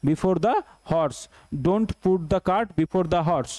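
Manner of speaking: careful, spaced-out classroom reading; slow dictation. Shown as a demonstration. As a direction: stretch every word of the proverb. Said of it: "teacher reads the proverb slowly".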